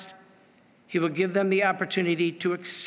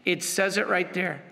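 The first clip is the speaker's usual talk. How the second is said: ordinary talk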